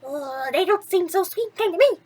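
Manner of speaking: odd little mouse voice, unconvinced; a mouse muttering his doubts; doubtful mouse. Acted. Mouse Jack doubts the sisters.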